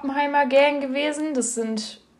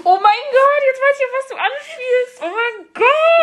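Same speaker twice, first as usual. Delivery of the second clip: much higher and louder oh my god; shocked realization; 12 semitones higher, 4 dB louder, slower than usual. Real, not acted. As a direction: squeal it as the memory hits. Remembers embarrassing story.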